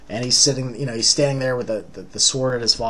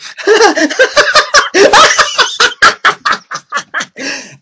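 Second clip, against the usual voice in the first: high, loud maniacal cackle; long crazed laugh, pitched way up; 11 dB louder than usual. Acted. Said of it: "laughing maniacally".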